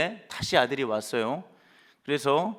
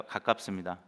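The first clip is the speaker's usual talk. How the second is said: ordinary talk, nothing marked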